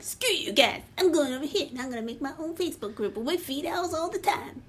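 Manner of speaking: lightly voiced bratty cartoon kid; a half-hearted bratty kid storming off; bad Cartman. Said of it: "That was a bad Cartman".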